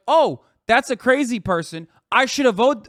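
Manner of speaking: normal talking voice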